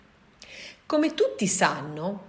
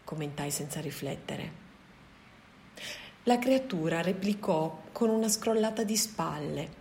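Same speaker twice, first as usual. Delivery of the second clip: usual speaking voice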